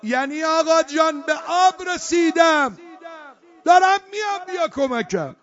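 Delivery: high, loud cry to a master; high intoned shout, dropping at the end; calling out. Acted. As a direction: call urgently across a battlefield. Acted call across the battlefield.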